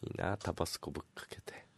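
soft unvoiced whisper. Real, not acted. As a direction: breathe it out in a whisper. Whispered aside after calm.

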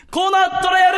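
shouted corner title call. Acted.

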